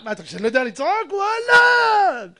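quick challenge exploding into a long, high yell; out-shouting someone. Acted. Preacher acting a man out-yelling his rival, shouting. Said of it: "ההוא צועק, אני צועק יותר".

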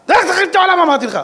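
loud, high-pitched angry order; exasperated shout. Retold or imitated. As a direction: yell the order at the angel. Retold order to the angel.

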